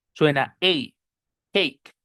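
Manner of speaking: "cake" with the English ei vowel; English long a. Shown as a demonstration. Teacher modeling the English vowel in "cake". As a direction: glide the A in "cake" from e to i.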